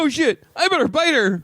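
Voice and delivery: high, put-on dog voice; imitating a dog's thoughts. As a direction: voice a panicky dog, high-pitched.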